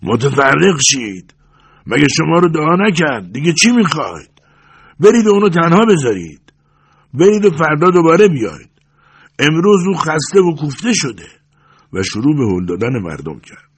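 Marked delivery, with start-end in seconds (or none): 0.0-11.3 high, raised voice ordering a crowd away; high, forceful yelling; loud hoarse shout. A narrator's reading. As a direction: shout at them to disperse. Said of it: "با صدایی بلند و دورگه فریاد کشید".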